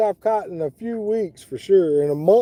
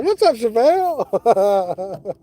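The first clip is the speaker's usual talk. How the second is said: slow, drawn-out hype hello; mock-excited shout-out; excited greeting; 4 semitones higher, much slower than usual. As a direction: stretch it out like spotting a friend live. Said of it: "'Cause I was like".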